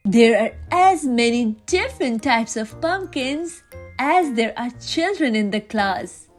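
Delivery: plain reading voice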